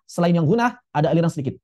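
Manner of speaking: ordinary delivery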